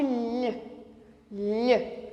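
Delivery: "lh" as a single isolated palatal L sound; sound demo. Shown as a demonstration. Teacher showing the sound of L H, isolated "lh". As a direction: softly say "lh" alone, pause, repeat it. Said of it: "eu faço som de".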